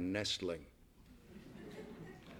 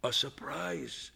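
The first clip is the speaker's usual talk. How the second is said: murmuring; 6 dB quieter, slower than usual. A narrator's reading. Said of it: "Albert murmured".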